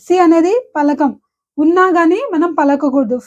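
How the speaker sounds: normal talking voice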